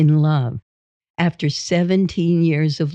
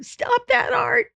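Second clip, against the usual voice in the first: very high mock-panicked squeal; frightened wife, imitated. Imitated wife running from husband, high frightened squeal. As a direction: squeal it sky-high without much volume. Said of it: "she'd start running".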